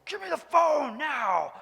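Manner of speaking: slow, loud, drawn-out shouted demand; mock calm voice. Husband claiming calm while shouting at wife, slow loud. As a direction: stretch out the phone, loud and slow. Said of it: "I said it in my calm voice".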